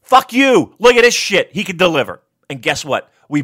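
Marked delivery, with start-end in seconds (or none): 0.0-2.2 high, loud taunting shout; crowing at the haters; mock gloating yell. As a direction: point and crow at the doubters. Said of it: "pointing at people being like".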